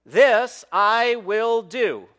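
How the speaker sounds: loud, high, ringing declaration; forceful scripture proclamation; powerful. Acted. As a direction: proclaim it slowly, phrase by phrase. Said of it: "He makes this powerful statement".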